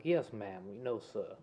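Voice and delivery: put-on polite, soft-spoken Southern accent; proper Southern accent. Practising manners for life in the South, proper Southern accent. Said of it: "practice your proper Southern accents".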